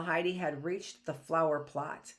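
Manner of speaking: usual speaking voice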